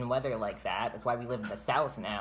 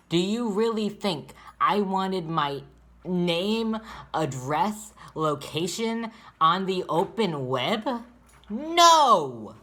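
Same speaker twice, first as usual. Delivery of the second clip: loud, high, slow furious outburst; real furious rant; 6 semitones higher, 9 dB louder, much slower than usual. Real, not acted. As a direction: let the rage build, then explode. Furious rant about being doxxed.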